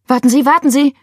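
high, loud, urgent plea; flustered panic. A narrator's reading. Laila asking the emergency operator to wait, panicked.

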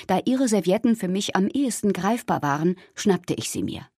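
ordinary delivery

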